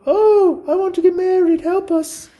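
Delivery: very high, excited squeal; a pleading falsetto begging to marry; high squeaky giddy voice. Acted. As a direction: squeal it like a lovestruck girl. Voicing a giddy character.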